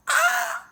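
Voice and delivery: high loud frightened scream; mock scream. Fan seeing Ron charge at him, high scream. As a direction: shriek like a scared fan.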